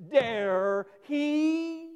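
"dare he" thrown out high and loud, stress on dare; emphatic dare he. Shown as a demonstration. Pastor showing the Greek word order, emphatic. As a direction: punch "dare he" as an incredulous outburst. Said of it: "to show the deep emphasis in the Greek".

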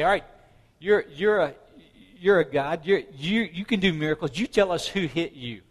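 mocking callers. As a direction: act out hecklers calling at a prisoner, stumbling over the jabs. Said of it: "People call at him and say".

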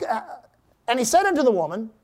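ordinary talk

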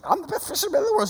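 high, rapid mimicry of a boast; mocking little-sister imitation. Brother complaining to Mom about sister's boast, mocking.

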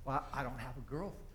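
soft, low mumbled denial; sheepish reply. Retold or imitated. Burly man answers police at his door, sheepish.

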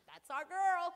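high, loud proud cheer. Retold or imitated. Grandma praising granddaughter, proud cheer. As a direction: beam with pride. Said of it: "I'm like".

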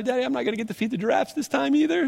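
little daughter voice. Retold or imitated. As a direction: voice a disappointed young child, soft and fast. Dad voices disappointed little daughter.

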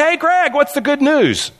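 imitated high-pitched breezy hail; casual greeting. Friend retold greeting the preacher, casual and high-pitched. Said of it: "very casually and said".

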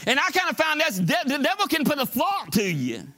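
very high, ringing sermon delivery; full-force preaching. Real, not acted. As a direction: drive each line home to the congregation, pitched high.

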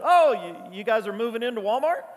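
loud teasing call across the store. Retold or imitated. Pastor teasing church members across Walmart, called out. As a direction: shout a joke across Walmart. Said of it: "I yelled out to her".

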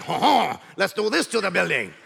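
hammed-up French laugh and speech; jokey, badly faked French accent; mock French accent. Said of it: "one guy's speaking French".

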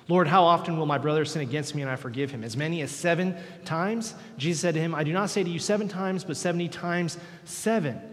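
loud, ringing Bible reading; scripture read from the pulpit; fervent scripture reading. Acted. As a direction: proclaim the verses to the church.

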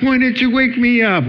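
high, indignant whine to his wife; husband scolding his wife for letting him sleep; complaining husband. Pretend. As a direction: protest like you were robbed of the ending.

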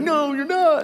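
high, alarmed protest; protesting disciples. Pretend. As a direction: blurt it out like panicked followers. Disciples protesting Jesus leaving.

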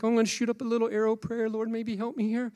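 a light, flippant mock arrow prayer; casual little prayer. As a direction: toss off a throwaway prayer. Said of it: "not just a little prayer".

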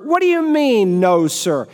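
high-pitched what do you mean; acted boss in disbelief; indignant disbelief. Boss reacts to bad news.

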